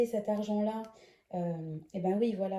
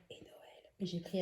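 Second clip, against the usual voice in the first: whispering; 3 semitones lower, 11 dB quieter than usual. Real, not acted. Children nearby, voice dropped.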